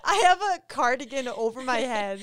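high, loud, helpless giggling; laughing through words. Real, not acted. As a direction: blurt it out while cracking up. Cracking up over cardigan on head.

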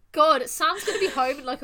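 normal talking voice

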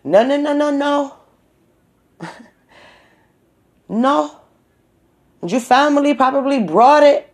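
high-pitched imitation of a man flipping out; mock freak-out. Shop man flipping out at a customer over covid, mock outrage. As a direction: rattle off indignant high refusals, then accuse. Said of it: "flips the fuck out".

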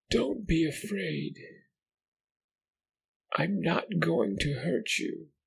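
odd parrot-like voice. Acted. Creature soothing the boy in a parroting voice. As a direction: sound like a creature imitating human kindness. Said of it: "Its voice was odd, the way a parrot would sound".

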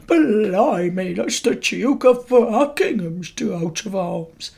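slow, hammed-up English guard; bad English accent. Guard amazed by the dagger's crest, bad English accent. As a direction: drag it out in a mock English accent. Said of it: "That was very nice impression".

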